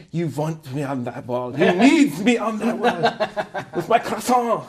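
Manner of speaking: put-on slow, laughing Jack-in-a-French-accent; hammed-up French accent on a movie line; a light French accent, laughing through it; mock French accent. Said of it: "maybe they tried it and he's like".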